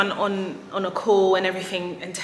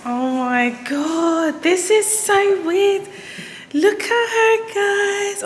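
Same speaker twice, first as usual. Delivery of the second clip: much higher, louder, slow gushing; thrilled squeal; 12 semitones higher, 7 dB louder, much slower than usual. Real, not acted. Buyer showing viewers her new car, thrilled. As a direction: squeal with delight.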